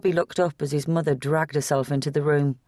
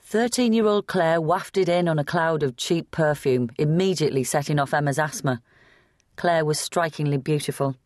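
plain reading voice

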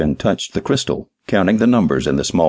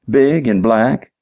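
dim-witted answer; 6 semitones higher, 3 dB louder, slower than usual. Acted. Clerk describes the car to detective, simple and earnest.

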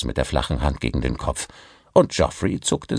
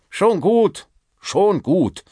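higher, louder, brushing it off; placating director; 13 semitones higher, 5 dB louder, much slower than usual. Acted. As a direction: wave the matter away hastily. Director placates, raises hands. (abwehrend beschwichtigend)

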